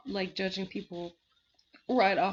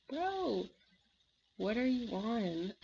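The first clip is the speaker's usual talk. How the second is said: mock disbelief; 3 semitones higher, slower than usual. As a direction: stretch it out in baffled amazement. Acted baffled reaction to Mark.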